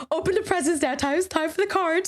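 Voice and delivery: put-on high, eager kids' chant; mock kid voice pushing to open cards; excited kids. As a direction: pester like impatient kids. Kid's excited demand retold.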